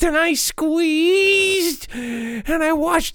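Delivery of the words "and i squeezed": slow, drawn-out menace; slow dramatic. Acted. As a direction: savor each word slowly. Dramatic retelling.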